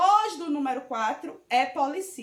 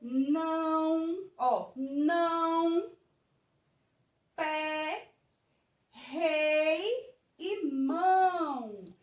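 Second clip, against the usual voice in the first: slow reading; much slower than usual. Shown as a demonstration. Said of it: "vocês estão vendo aqui a palavra".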